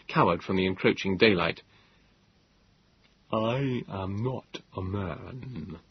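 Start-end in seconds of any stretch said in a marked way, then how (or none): 3.3-5.8 very slow, soft and cold, no warmth at all; cold quiet voice. Acted. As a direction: speak it like a chill from the shadows. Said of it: "in his cold, quiet voice".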